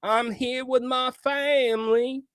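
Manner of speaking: imitating a bad, put-on Southern accent, high-pitched; mock Southern accent. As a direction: mimic a coach's terrible fake Southern twang. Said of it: "the worst accent I have ever heard".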